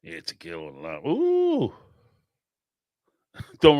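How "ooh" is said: high ooh. Real, not acted. Recalling tequila and lime.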